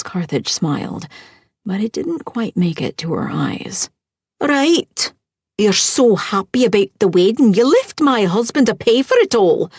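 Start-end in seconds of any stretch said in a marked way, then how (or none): 4.4-9.7 higher, louder stepmother jab; bitter accusation. Acted. Stepmother blaming the bride over wedding costs, high and louder. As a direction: spit it out, much higher and louder.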